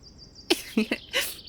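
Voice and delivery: high, airy giggle; breathless, amused laugh; gleeful laugh. Acted. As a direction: giggle at their embarrassment. Delighted by listener's embarrassment.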